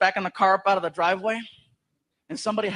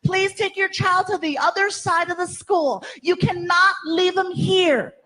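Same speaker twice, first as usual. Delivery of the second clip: higher, slow prim official voice; stiff principal; 9 semitones higher, 4 dB louder, slower than usual. Retold or imitated. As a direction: scold the parent like a fussy principal. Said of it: "Here comes the principal".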